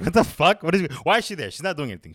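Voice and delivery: high, loud exasperated outburst; outraged viewer. Retold or imitated. Mocking a confused viewer, high and loud. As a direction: blurt it out in high loud disbelief.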